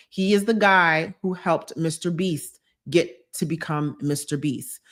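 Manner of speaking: usual speaking voice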